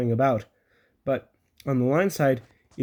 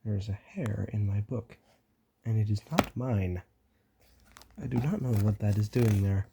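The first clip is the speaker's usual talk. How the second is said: quiet aside; 4 semitones lower, 5 dB quieter than usual. Real, not acted. Aside to listeners.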